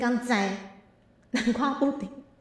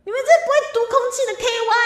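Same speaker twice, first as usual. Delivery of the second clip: much higher, louder, fast scolding; mock angry yell; 9 semitones higher, 6 dB louder, much faster than usual. Streamer scolding viewers who cannot read the room, hammed-up angry yell. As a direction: shout down the clueless chat in hammed-up fury. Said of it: "我在生气".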